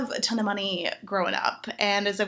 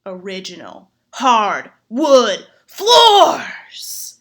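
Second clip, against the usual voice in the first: very slow, much louder ecstatic reveal; climactic reveal; 10 dB louder, much slower than usual. Retold or imitated. The gentleman's big reveal, a slow loud ecstatic cry. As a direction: belt it like a climax. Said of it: "He's like".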